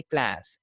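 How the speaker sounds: "class" said KLASS, vowel as in cat; American pronunciation. Shown as a demonstration. Teacher showing how Americans say it, "class" with ae. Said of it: "the sheep sound".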